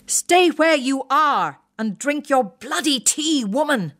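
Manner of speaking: high, slow, emphatic officer's bellow; retired officer roaring at his wife to stay put; plummy Empire ruling voice. Acted. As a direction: lord it over her in a plummy English roar. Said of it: "The Empire ruling voice, with its plummy English accent".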